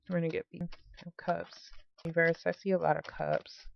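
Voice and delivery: heavy breaths into the mic; breathing hard. Real, not acted. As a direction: talk through hard breathing. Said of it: "Sorry if I'm, like, breathing hard".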